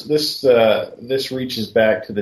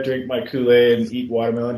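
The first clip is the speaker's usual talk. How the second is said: normal talking voice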